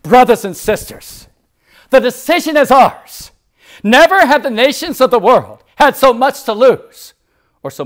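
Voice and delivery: JFK-style speech delivered to the church; oratory quote. Retold or imitated. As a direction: proclaim it like a statesman to the nations. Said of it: "these are the words he said".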